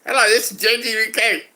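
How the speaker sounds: hammed-up imitation of J G; a clumsy mock J G voice; bad impression of J G. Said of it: "That was a terrible impression".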